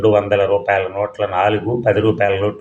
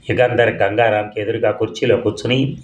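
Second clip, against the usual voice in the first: plain reading voice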